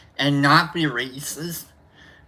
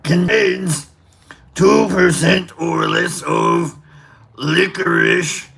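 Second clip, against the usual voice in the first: Cookie Monster voice; slower than usual. Pretend. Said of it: "in the voice of Cookie Monster".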